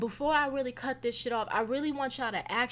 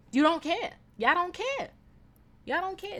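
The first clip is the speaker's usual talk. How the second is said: higher, insistent repetition; accusing; 8 semitones higher, 4 dB louder than usual. Real, not acted. Scolding inconsiderate people, accusing. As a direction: press the accusation.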